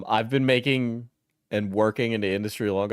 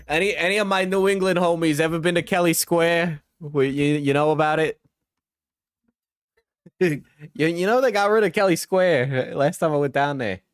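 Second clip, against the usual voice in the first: higher, louder hammed-up Brooklynese accent; 7 semitones higher, 4 dB louder than usual. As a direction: chat like a New England street guy in a Brooklynese accent. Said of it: "I can't help but do, like, a Brooklynese accent".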